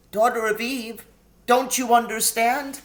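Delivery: high, anxious faun voice; a fretful, sorrowful faun. A narrator's reading. Tumnus pleading with Lucy.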